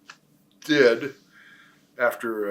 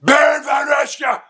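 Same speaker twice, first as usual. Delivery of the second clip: high, loud, slow villain bellow; booming German heel voice; wrestling persona announcement; 8 dB louder, slower than usual. Shown as a demonstration. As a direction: roar the name like a ring villain. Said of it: "I became".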